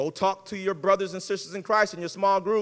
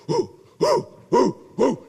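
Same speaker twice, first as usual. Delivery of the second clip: high hooting whoo calls; football chant hoots; 5 semitones higher, much slower than usual. Retold or imitated. Football players hyping up before the stadium, hooting. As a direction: hoot like players before the game. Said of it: "the football players ... they go".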